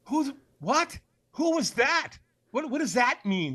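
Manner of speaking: baffled high questions; confused, calling after someone gone; bewildered. Retold or imitated. Bewildered after stranger vanishes.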